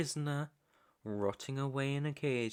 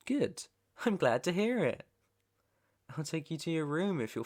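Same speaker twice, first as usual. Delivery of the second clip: high, loud burst of approval; pleased approval; 5 semitones higher, 4 dB louder than usual. Acted. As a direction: switch to pleased, raised voice. Captor pleased after captive agrees.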